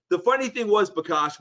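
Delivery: ordinary talk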